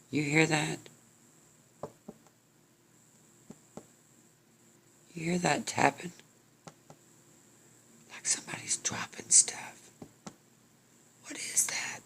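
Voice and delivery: high hushed voice fading into a whisper; hushed alarm. Acted. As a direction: whisper as if listening for a noise. Spooked man listening in cemetery.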